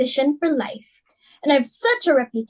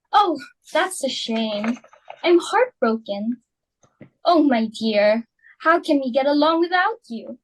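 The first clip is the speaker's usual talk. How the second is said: fake sympathy. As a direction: pretend to be crushed, laying it on thick. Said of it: "Well, I'm glad she dropped out".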